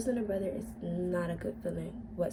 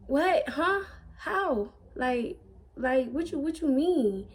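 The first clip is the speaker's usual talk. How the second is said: shocked disbelief; 6 semitones higher, 6 dB louder, slower than usual. Retold or imitated. So confused on what she was saying.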